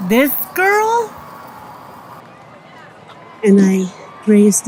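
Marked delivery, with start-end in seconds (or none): 0.1-1.1 pointed question. Retold or imitated. Guard revealing the girl beside him, high pointed question. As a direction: pitch climbing very high on "this girl".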